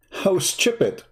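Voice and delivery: slow mocking repeat through a laugh. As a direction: laugh at the comment while drawing it out. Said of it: "He said".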